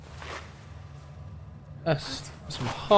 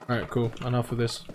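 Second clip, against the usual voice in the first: ordinary talk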